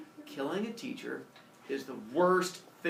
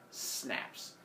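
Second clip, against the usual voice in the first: ordinary delivery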